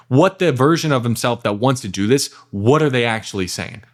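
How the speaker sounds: ordinary delivery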